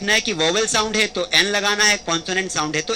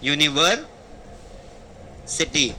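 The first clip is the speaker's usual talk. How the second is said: slow spelled-out word; 5 semitones lower, 5 dB quieter, much slower than usual. Shown as a demonstration. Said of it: "कौन सी city?".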